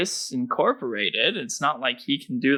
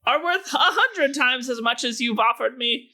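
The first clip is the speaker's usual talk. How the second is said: halfling rogue voice; 3 dB louder than usual. Acted. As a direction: pound the table and declare it in a high voice. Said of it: "pounds his little halfling fist on the table and says".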